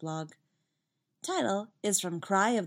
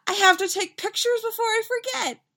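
high, loud, gushing mother; excited mom; 5 dB louder than usual. A narrator's reading. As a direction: exclaim it, rushing for the camera. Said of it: "Mom cries".

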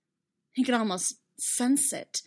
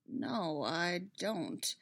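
softer, measured admission; slowly; 2 semitones lower, 6 dB quieter, much slower than usual. A narrator's reading. Prisoner admits he has no advocate, slowly. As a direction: say it softly, taking your time. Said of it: "Obi-Wan said slowly".

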